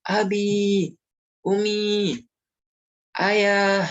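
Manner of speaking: put-on child running to greet parents, thrilled; excited greeting. Shown as a demonstration. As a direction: call out happily as parents come home. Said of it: "disambut lari-lari dari dalam rumah".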